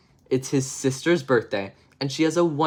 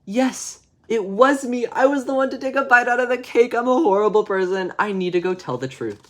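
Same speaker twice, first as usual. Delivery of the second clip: high, hammed-up remorseful wail; guilty wailing confession; 9 semitones higher, 3 dB louder than usual. David guilty in bed over the cake, wailing mock confession. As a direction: confess in a high, overwrought child's voice. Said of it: "he feels a little bit guilty".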